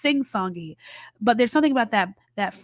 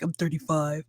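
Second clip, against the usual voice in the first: lower, softer mock voice of a sulky young man; deflated guy; 5 semitones lower, 5 dB quieter than usual. Young writer answering Celine, lightly voiced sulky reply. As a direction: mutter it like a put-out guy. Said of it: "He's like".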